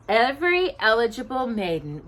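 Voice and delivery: ordinary delivery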